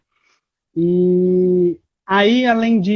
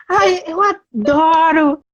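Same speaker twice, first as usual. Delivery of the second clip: put-on high, slow, gushing children's TV host voice; 9 semitones higher, slower than usual. Mocking how not to talk at a job interview, high gushing host voice. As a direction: gush it out like an overexcited kids' show host. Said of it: "falando igual a [name]".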